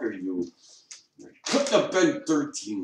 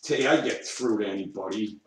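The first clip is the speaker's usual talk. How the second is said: ordinary talk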